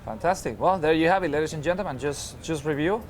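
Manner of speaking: ordinary talk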